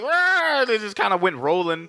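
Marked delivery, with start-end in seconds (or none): 0.0-0.6 high mock battle roar. S0 voicing Kong charging Godzilla, mock roar. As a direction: bark out a cartoon roar. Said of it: "he just kinda like".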